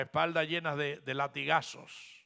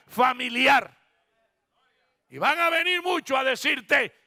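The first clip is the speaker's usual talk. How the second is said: loud, high pulpit proclamation; impassioned preacher's cry; preaching at full force; 7 semitones higher, 8 dB louder than usual. Acted. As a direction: declare it to the congregation, raised and emphatic.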